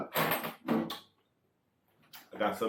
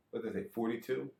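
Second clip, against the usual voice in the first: ordinary delivery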